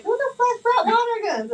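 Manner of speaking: imitating an angry parent, very high and loud; high-pitched mock yell of outrage; mad parent. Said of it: "One parent got mad".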